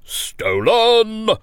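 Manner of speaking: loud, high royal accusation; exclaimed. Acted. King exclaiming to the jury, loud accusing cry. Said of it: "the king exclaimed".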